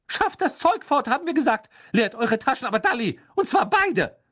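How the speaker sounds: high, loud angry orders; furious scolding. Acted. Mother orders twins to empty pockets, furious high shouting. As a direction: bellow at the twins in a high-pitched rage.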